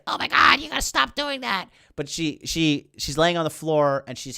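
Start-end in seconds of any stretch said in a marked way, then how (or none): 0.1-1.7 high whiny mock Rey voice, rushed on the last words; a breathy gasp, then squeak it out like a scared girl. Rey begs Palpatine to stop, mocking high whine. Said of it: "so Rey's like".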